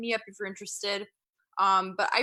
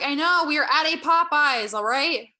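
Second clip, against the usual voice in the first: louder, higher exasperated reply; exasperated retort; 5 semitones higher, 8 dB louder than usual. Acted. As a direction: fire it back at dad. Retorting to her dad.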